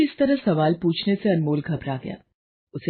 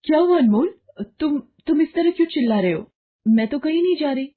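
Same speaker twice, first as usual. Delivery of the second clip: much higher, louder worried questions; alarmed wife; 10 semitones higher, 4 dB louder than usual. Acted. Wife startled by husband's screaming, much higher and louder. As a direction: rush to calm his panic.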